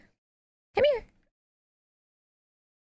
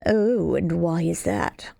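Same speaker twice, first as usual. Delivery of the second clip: slower, lower, mocking villain question; more distinct sponsor voice; 4 semitones lower, slower than usual. Shown as a demonstration. Streamer voicing the sponsor differently from Yugamu, slow mocking villain. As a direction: make the villain sound separate from the assassin. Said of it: "I'm trying to give them more of".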